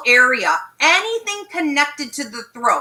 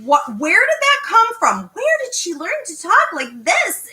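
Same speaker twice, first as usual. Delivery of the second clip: high, incredulous disbelief; mock stunned at how she talks; retold shock; 7 semitones higher than usual. Coach retelling her stunned reaction to a client's voice, high and incredulous. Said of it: "And I'm like".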